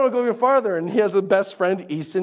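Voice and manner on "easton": ordinary delivery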